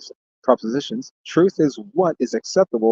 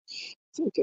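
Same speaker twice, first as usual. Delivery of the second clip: whisper; 12 dB quieter, slower than usual. Real, not acted. Repeated as a whisper.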